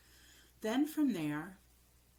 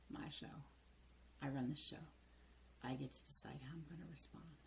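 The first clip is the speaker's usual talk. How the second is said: soft whisper, quiet and steady; whispered self-talk; 2 semitones lower, 9 dB quieter, faster than usual. Retold or imitated. Coach retelling her own calming mantra, whispered. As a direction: murmur it to yourself. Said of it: "And I say".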